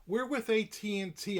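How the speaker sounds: high, slow mock sales pitch; imitated salesperson. Voicing the salesperson in retelling.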